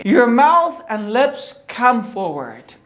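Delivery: very slow, each word drawn out; slow teaching delivery. Shown as a demonstration. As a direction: spell it out slowly for learners. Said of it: "به خاطر آرتیکولیشن حرف R". (آهسته و شمرده برای آموزش)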